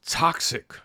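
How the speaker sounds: whispered sneering "toxic."; mock sneer. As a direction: hiss "toxic." as a breathy mock buzzword. Mocking critics' buzzword again.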